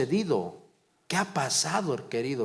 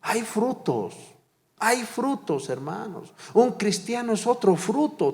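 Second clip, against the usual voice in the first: high, loud preaching at full force; fervent preaching; 8 semitones higher, 3 dB louder than usual. Acted. Pastor urging the brethren to keep bearing fruit, fervent and high. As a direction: lift the voice and drive the point home.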